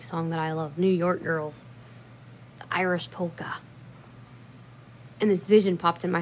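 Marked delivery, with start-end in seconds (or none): none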